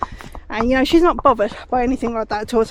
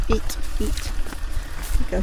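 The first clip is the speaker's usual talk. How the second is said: whispered, soothing the horse; soft coaxing whisper; 5 dB quieter, much slower than usual. Real, not acted. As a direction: hush it to the pony. Soothing horse while she grazes.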